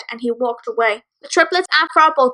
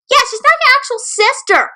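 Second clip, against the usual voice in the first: much higher, jeering taunt; mocking yell; 12 semitones higher, 4 dB louder than usual. Bully jeering at the siblings, high mocking yell. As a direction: sneer it out to wound her. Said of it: "Dancer yelled with the same voice".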